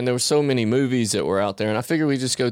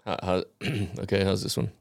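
normal talking voice

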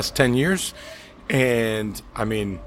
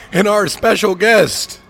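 big, raised announcer voice; high, punchy host welcome; show announcer intro; 6 semitones higher, 4 dB louder than usual. Acted. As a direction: ring out each name like a ring announcer. Podcast host intro, announcer style.

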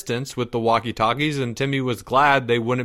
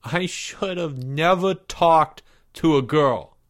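slow, higher-pitched miserable whine; moaning; 5 semitones higher than usual. A narrator's reading. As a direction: moan it out, stretching the words. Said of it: "Porge moaned".

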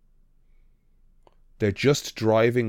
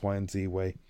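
ordinary delivery

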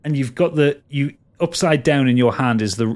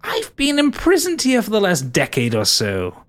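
lightly voiced prisoner; 8 semitones higher than usual. Oropan answers in voice.

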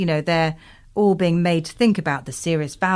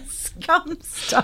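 slow, high, soft squeak through laughter; wheezy high giggling repeat; helpless laughter; 9 semitones higher, 4 dB quieter, much slower than usual. Real, not acted. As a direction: barely get it out for laughing. Repeats the joke while laughing helplessly.

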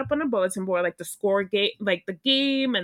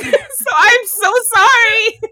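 laughing apology; 11 semitones higher, 10 dB louder, slower than usual. Real, not acted. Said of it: "we went somewhere on that one".

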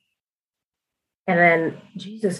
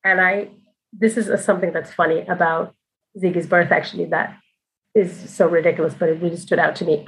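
ordinary talk, nothing marked

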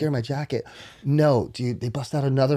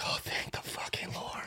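a laughing whispered sigh of relief; relieved whisper; 14 dB quieter than usual. Retold or imitated. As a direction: breathe it out in relief, half laughing. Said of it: "and I'm like".

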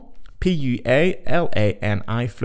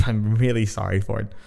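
ordinary talk

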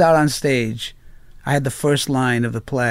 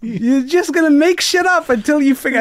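incredulous; 12 semitones higher than usual. Retold or imitated. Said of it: "looking at me like".

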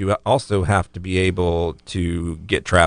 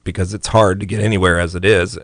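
ordinary talk

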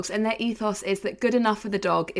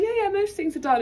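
mock breezy; 10 semitones higher than usual. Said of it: "and I'm like".

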